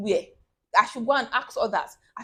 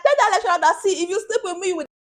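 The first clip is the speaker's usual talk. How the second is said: high, loud preacher's declaration; fired-up church preaching voice; excited pastor; 9 semitones higher, 5 dB louder, faster than usual. Retold or imitated. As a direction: proclaim it boldly like a pastor from the pulpit. Pastor's advice retold.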